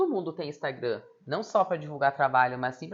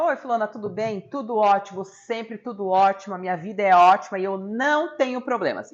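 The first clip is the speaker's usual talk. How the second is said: higher, louder, insistently "all is great"; mock emphatic denial of any trouble; imitated cheery old lady; 5 semitones higher, 7 dB louder than usual. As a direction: overplay an old lady's everything-is-wonderful act. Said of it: "que ela me dizia assim".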